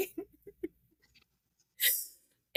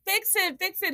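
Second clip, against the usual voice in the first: higher, urgent pleading; panicked demand; 9 semitones higher than usual. Retold or imitated. Telling her child's father to fix the gutter, higher urgent demand. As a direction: beg him to fix it. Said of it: "I was like".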